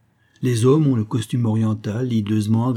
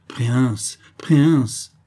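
"Préince" breathed, then "préince" louder in rapture; intoxicated delight; 3 dB louder, much slower than usual. Acted. Tartarin relishing the prince's title, elated. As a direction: savour "préince" twice with joy. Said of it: "répétait-il avec ivresse".

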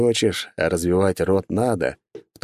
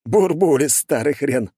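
grinning; 7 semitones higher, slower than usual. A narrator's reading. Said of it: "улыбнулся в тридцать два зуба".

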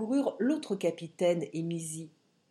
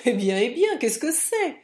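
loud, lively and jovial; cheerful, bouncy question; playful tone; 6 semitones higher, 7 dB louder than usual. A narrator's reading. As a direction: ask it breezily, pitch lifted. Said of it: "dit Mick d'un ton enjoué".

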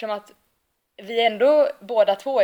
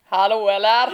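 brash Gothenburg call; mock Gothenburg accent; 4 dB louder, slower than usual. Arguing Gothenburg dialect is not sexy, mock Gothenburg accent. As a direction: ham up a loud-mouthed Gothenburg dialect. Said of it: "Göteborgskan är ju så här".

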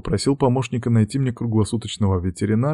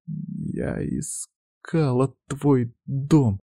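trembling; 5 semitones higher, 5 dB quieter, much slower than usual. A narrator's reading. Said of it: "трясясь".

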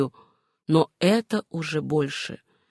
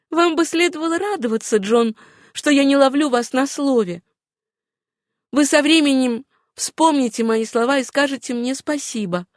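higher-pitched woman's voice; Suzanne's voice; 6 semitones higher than usual. Acted. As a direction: chide him lightly in a raised voice. Героиня прощается с Джоном. (высокий голос героини с лёгким укором)

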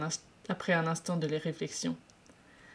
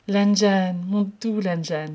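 slow, tender calling of the name; murmured; 7 dB louder, slower than usual. Acted. Wei Ying murmurs Lan Zhan's name, slow and tender. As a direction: savour each word lovingly. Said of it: "murmura-t-il".

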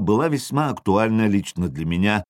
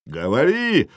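raised, abrupt jarl's shout; yelled command; 4 semitones higher, slower than usual. A narrator's reading. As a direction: shout back as the enemy jarl. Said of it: "заорал Ярл".

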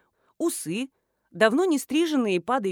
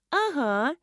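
put-on higher, quick girlish reply; blurting schoolgirl; 5 semitones higher than usual. A narrator's reading. Schoolgirl confirms she knows, blurting it out. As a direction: blurt it like an eager kid. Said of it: "выпалила школьница".

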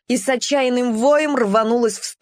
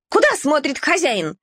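yapping; 5 semitones higher than usual. A narrator's reading. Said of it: "тявкнула Вилька".

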